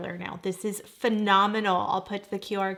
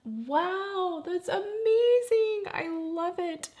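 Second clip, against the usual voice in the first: high, drawn-out amazement; thrilled, swooping high on amazing; awestruck delight; 10 semitones higher, slower than usual. Real, not acted. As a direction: gush in wonder, voice soaring. Thrilled by how lashes look.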